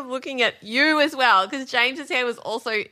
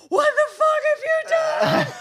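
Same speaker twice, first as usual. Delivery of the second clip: high, louder mock outburst; imitated shocked anger; 3 dB louder than usual. Wife mimics husband's shocked reaction to haircut, mock outrage. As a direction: throw it out like a stunned husband. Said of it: "you'd looked at it and just were like".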